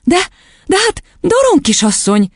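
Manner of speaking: high, halting protest; indignant. A narrator's reading. Teacher objecting to the headmistress, indignant. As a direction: splutter in indignation. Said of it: "háborodott fel a tanítónéni".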